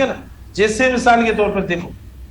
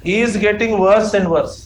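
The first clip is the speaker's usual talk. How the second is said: slow, deliberate words; slow dictation; much slower than usual. Shown as a demonstration. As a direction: dictate the sentence slowly. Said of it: "teacher dictating". (धीरे-धीरे लिखवाना)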